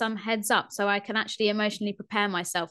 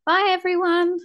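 high squeaky louder goodbye; helium voice; 9 semitones higher, 7 dB louder than usual. Acted. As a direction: chirp it like a cartoon chipmunk. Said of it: "my voice is so".

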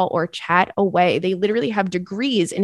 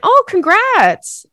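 surprised congrats; 12 semitones higher, 8 dB louder, much slower than usual. Real, not acted. Reacting to listener's letter.

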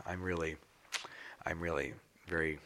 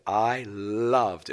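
emphatic; 3 semitones higher, 8 dB louder than usual. Retold or imitated. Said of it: "in all capitals".

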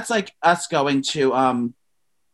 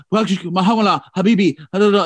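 mock Arabic accent, a bit louder; 2 semitones higher, 4 dB louder than usual. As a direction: gibberish imitating Arabic accent. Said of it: "talking all, um, Arabic".

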